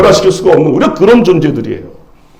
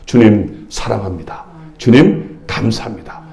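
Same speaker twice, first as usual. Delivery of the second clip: low, soft words to God; quietly modelled prayer of thanks; prayerful confession; 7 semitones lower, 7 dB quieter than usual. Shown as a demonstration. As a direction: drop into a hushed, humble devotion.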